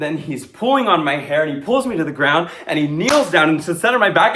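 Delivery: loud, urgent, gathering speed; rising panic. Acted. Victim reliving being pinned down, rising panic. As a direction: drive it forward in alarm.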